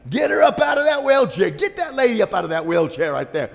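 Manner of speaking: high, loud order that eases off; commanding shout. Retold or imitated. Pastor's wife ordering ushers to lift her, shouting. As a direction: bark it at the ushers. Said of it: "That's what the pastor's wife said".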